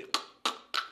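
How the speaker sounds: q clicked far back in the throat; deep q sound. Shown as a demonstration. Teacher demonstrating the uvular q, deep in the throat. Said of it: "c'est ce niveau-là".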